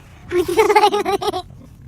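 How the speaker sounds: loud burst of laughter breaking the take; laughing fit that ruins the take. Real, not acted. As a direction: crack up mid-scene. Actress cracks up during vows.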